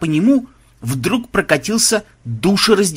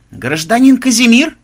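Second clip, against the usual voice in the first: raised, louder official question; stern; 9 semitones higher, 6 dB louder than usual. A narrator's reading. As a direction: challenge him sternly at the door. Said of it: "сурово спросил Махмуд". (сурово, громко)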